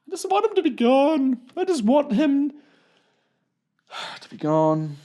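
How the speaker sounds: high, loud desperate whine; worked-up high-pitched pleading; exasperated plea. Acted. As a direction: wail it, pushed high with frustration. Worked-up venting about wanting Trump gone.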